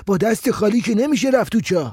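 high-pitched objection from the crowd; man in the crowd. A narrator's reading. Someone in the dark saying they need rope, high voice.

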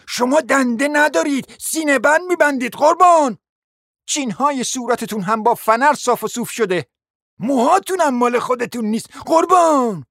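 high, scolding list of insults; scornful tirade. Acted. Lady exposing the prince's fake parts, scolding scornfully. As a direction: pile it on with rising indignation.

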